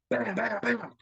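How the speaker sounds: high rapid bam bam knocks; imitated door banging. Locked out at night, banging on the door with mock knocks. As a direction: pound out the knocks with your voice.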